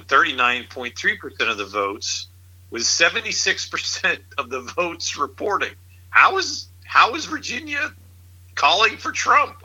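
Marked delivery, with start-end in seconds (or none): 6.1-9.6 can't believe what he's reading; disbelief. Real, not acted. Incredulous at election results.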